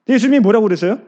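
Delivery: ordinary talk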